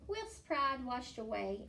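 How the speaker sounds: plain reading voice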